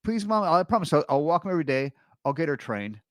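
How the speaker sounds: put-on high-pitched begging kid, settling down; imitated child promising eagerly; pleading five-year-old. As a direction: beg like a little child. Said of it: "you know, a five-year-old".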